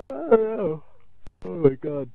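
words stretched through a yawn. Real, not acted. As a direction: talk mid-yawn. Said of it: "I can't stop yawning".

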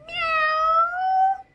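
long high meow; playful cat cry. Pretend. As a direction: stretch out a high kitty meow. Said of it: "And what do they say?".

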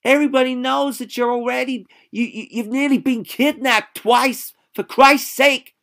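Baldwin at her wits' end; desperate. Acted. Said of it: "looked increasingly desperate".

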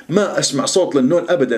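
normal talking voice

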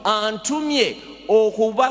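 ordinary talk

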